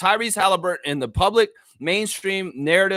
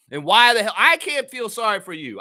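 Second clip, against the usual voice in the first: normal talking voice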